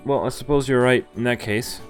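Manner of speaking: normal talking voice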